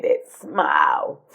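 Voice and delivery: low, slow, on the edge of crying; real grief dragging the words down; tearful. Real, not acted. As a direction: draw it out as the voice gives way. Remembering the dead friend's smile.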